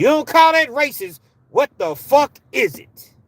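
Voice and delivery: high, loud, indignant shouting; furious challenge. Real, not acted. Challenging doubters over a handcuffed man being shot, furious.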